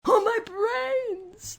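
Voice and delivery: high, long-drawn tearful wail. A narrator's reading. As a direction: wail it out slowly in comic despair. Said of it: "wailed the Scarecrow".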